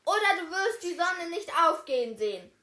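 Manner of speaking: loud, high, bold demand; the knight demands the princes back; calling out. A narrator's reading. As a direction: order the giant fearlessly. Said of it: "rief die Ritterin".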